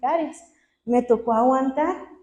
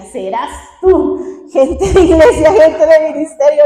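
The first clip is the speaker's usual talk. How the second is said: loud, high mock protest; retold defiant retort; 3 semitones higher, 11 dB louder than usual. Daughter rejecting her father's hated phrase, loud mock defiance. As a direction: throw the phrase back, loudly and indignantly. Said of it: "una frase que yo odiaba".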